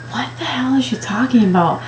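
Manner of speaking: shocked whisper under the breath; inner whisper. Retold or imitated. Shopper hearing the clerk, a stunned whisper. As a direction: whisper it to yourself in disbelief. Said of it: "And I'm like".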